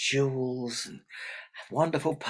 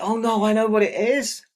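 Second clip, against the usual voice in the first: high, loud dawning alarm; feigned realisation; 9 semitones higher, 8 dB louder than usual. Acted. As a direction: announce it as a sudden dreadful discovery. Old woman feigns realisation.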